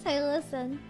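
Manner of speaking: ordinary talk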